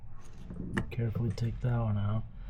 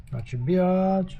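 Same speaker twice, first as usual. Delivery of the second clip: higher, slow, sassy mock protest; mock sass; 8 semitones higher, 3 dB louder, slower than usual. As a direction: tease the helper with a drawn-out jab. Joking refusal to helper.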